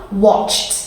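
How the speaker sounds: "Watched" with the t clearly released after ch; strong final t. Shown as a demonstration. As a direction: let them hear the t ending "Watched". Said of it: "do you hear that T sound".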